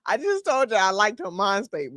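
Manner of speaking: usual speaking voice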